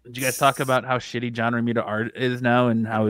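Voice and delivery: normal talking voice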